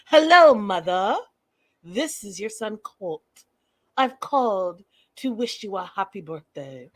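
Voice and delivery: loud, eerie Hello, then a creepy, formal greeting; hammed-up creepy mama's boy, strange timbre; Norman Bates voice. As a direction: play the unsettling son on the phone. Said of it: "Norman Bates kind of talking".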